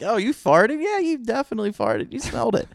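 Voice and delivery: high mock accuser voice; mock accusing. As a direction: accuse him, hammed-up and high. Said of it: "That's like".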